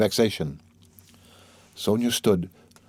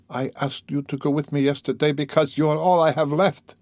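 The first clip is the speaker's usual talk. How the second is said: higher, earnest appeal; troubled man, imploring; 7 semitones higher than usual. Acted. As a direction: appeal to her as your last support.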